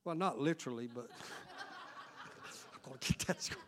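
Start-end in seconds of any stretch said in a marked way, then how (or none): 2.7-3.6 mock whisper. Mock inner voice chasing squirrel.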